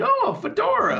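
high-pitched mock delight; delighted exclamation. Co-host retelling a man cooing over a fedora, delighted exclamation.

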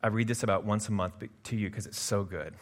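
ordinary delivery

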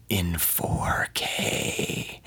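very slow, drawn-out whisper; seductive whisper. Retold or imitated. Filmmaker teasing actor with resolution, slow seductive whisper. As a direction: whisper it slowly to tantalize.

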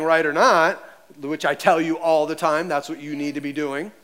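normal talking voice

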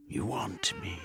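light feline come-hither; purring cat woman. A narrator's reading. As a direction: purr it as an invitation. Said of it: "she purred".

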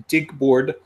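thick mock Russian accent. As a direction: lean hard on a Russian accent. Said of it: "Really leaning on that Russian accent there".

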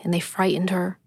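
usual speaking voice

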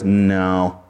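low, curt rejection; Adam shrugging off a dog, low flat no; flat dismissive no. Retold or imitated. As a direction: turn the dog down flatly. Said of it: "and goes".

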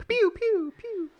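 very high pew pew sounds; fireworks noise. Pretend. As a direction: squeak out toy laser fireworks. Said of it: "make it sound like fireworks".

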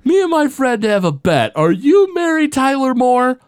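hammed-up drunk barfly asking a woman; Barney impression. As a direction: lean in with a goofy put-on voice. Barney asks Patty a question.

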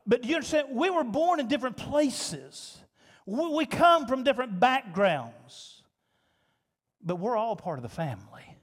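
usual speaking voice

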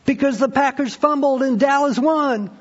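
high, insistent fan voice; fan's answer. Retold or imitated. Preacher voices a happy fan explaining the result, high and emphatic. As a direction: answer like it's obvious. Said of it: "They will give you exactly the same answer".

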